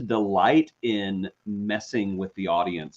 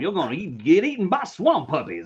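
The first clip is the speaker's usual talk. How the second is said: put-on blustering southern accent; 9 semitones higher than usual. Threatening co-host with the swamp, hammed-up southern bluster.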